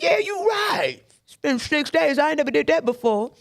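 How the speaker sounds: a lightly voiced man giving in; convinced drinker. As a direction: talk yourself into the drink. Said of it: "it was convincing him like".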